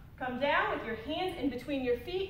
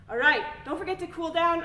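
out of breath; 5 semitones higher, 5 dB louder than usual. Real, not acted. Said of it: "I'm already out of breath".

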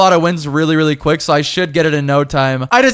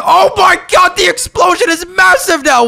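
excited shout; 12 semitones higher than usual. Real, not acted. To friends watching the bomb blast, excited shout. Huge explosion in game.

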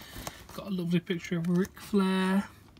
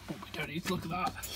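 quiet aside; 9 dB quieter, faster than usual. Real, not acted.

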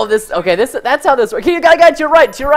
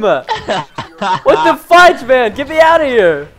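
laughing; 3 dB louder, much slower than usual. Real, not acted. Laughing while stuck in game.

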